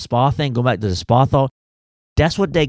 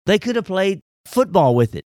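high, excited mock exasperation; 8 semitones higher than usual. Real, not acted. Mocking the added rule.

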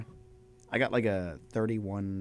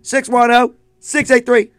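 hyped announcer; 12 semitones higher, 14 dB louder than usual. Acted. Hyped-up phone number call.

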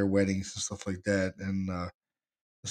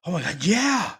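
higher, louder mock excitement; thrilled exclamation, retold; excited woman imitated; 7 semitones higher, 5 dB louder than usual. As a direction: imitate an excited guest's outburst. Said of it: "you hear the excitement in her voice".

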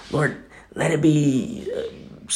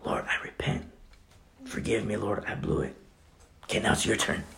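hushed, much softer prayer of repentance; whispered prayer; 4 semitones lower, 11 dB quieter than usual. Shown as a demonstration. Preacher showing a friend how to repent, whispered. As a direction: whisper it humbly, leading the way. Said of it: "I'll go first".

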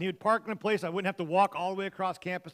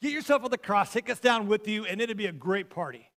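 loud, higher-pitched jeer, easing toward the end; mocking; 4 semitones higher, 6 dB louder than usual. Thief on the cross jeering at Jesus, mocking. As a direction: taunt him like a heckler calling out. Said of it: "one thief is mocking him".

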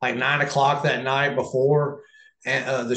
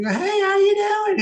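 high, slow put-on voice of a scary old wrestler; Abdullah the Butcher voice; slower than usual. Wrestler recalls meeting Abdullah, mimicking his high slow greeting. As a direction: ham up the big man's odd greeting, high and drawn out. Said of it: "You know, he's got that voice".

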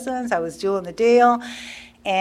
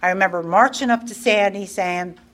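ordinary delivery

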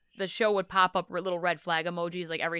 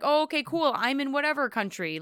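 mock polite; 6 semitones higher than usual.

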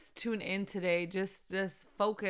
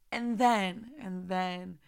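ordinary delivery

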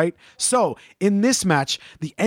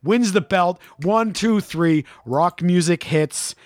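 usual speaking voice

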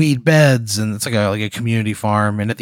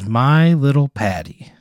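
slow, drawn-out ghost voice, pitched up; ghostly taunt; 4 semitones higher, slower than usual. Acted. Ghost to Patty in the hallway, eerie taunt. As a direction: stretch out the pet name eerily.

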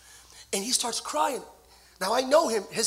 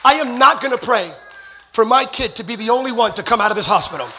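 loud preacher's retelling, building to a high shout; full-force preaching; 4 semitones higher, 15 dB louder than usual. Retold or imitated. Preacher retells a father's vow to God, full-force preaching. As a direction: declare the retold vow, louder towards the end.

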